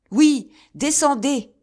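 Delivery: loud, high, slow furious yell; furious shout. A narrator's reading. Raoul shaking his fist at the castle, furious. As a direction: hurl it up at the castle. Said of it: "dit Raoul, furieux".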